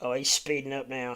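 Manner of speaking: usual speaking voice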